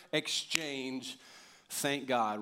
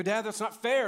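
put-on whiny kid; 6 semitones higher, 3 dB louder than usual. Kids complaining, retold.

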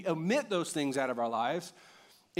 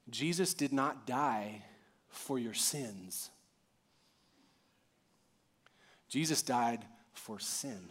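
slow and soft, with weighty pauses; measured, hushed gravity; slow, solemn; 5 semitones lower, 5 dB quieter, slower than usual. Real, not acted. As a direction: let each phrase land slowly and quietly. Solemn restatement of main point.

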